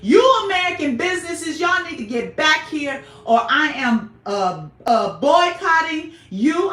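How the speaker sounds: calling out American businesses loudly; loud rant. Real, not acted. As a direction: shout it at the businesses. Ranting call-out to businesses.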